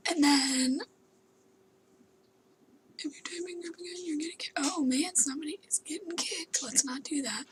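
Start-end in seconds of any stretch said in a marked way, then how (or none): 3.0-4.5 whispering. Real, not acted.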